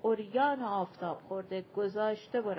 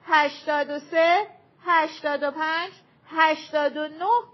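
counting out loud; 6 semitones higher, 9 dB louder than usual. A narrator's reading. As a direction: call the numbers against the wall.

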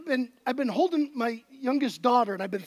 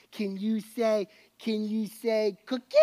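put-on coaxing baby talk; 5 semitones lower than usual.

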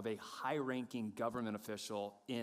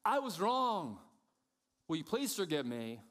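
owning it; 3 semitones higher than usual. Acted. Wrongdoer owns the fault, firm then calmer. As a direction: admit it firmly, then ask evenly. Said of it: "No, own it".

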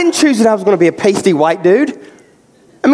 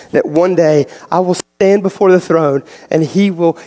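ordinary talk

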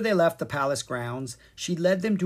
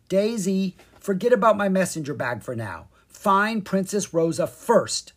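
firm command; 7 semitones higher, 6 dB louder than usual. A narrator's reading. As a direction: order the pig firmly to the trail. Zed giving orders to pig.

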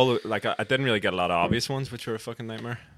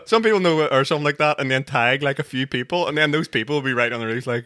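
normal talking voice

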